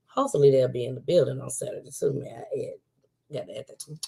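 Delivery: fast, dropping into a whisper; hushed aside. Real, not acted. To her viewers, a quick hushed aside. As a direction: slip it in under your breath.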